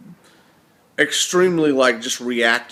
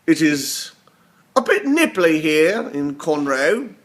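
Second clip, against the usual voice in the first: put-on British accent, higher and louder; mock Cockney accent; 4 semitones higher, 4 dB louder, slower than usual. Vlogger greeting the universe on a nippy Monday, mock Cockney accent. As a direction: greet in a playful fake Cockney. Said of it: "This is my Cockney accent versus my British accent".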